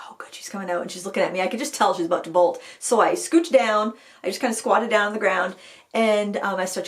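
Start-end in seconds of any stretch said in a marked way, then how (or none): 0.1-0.8 whispered relief. Retold or imitated. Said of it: "unvoiced whisper".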